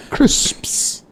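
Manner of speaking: "Crisps" drawn out on its final s-p-s; corrective pronunciation. Shown as a demonstration. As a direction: model "Crisps" with every end consonant sounded. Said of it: "Uh-uh".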